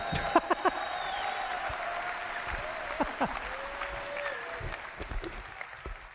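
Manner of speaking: long high breathy nervous giggle; nervous giggling. Real, not acted. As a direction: laugh off the nerves, high and airy. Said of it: "I'm sorry, I'm nervous".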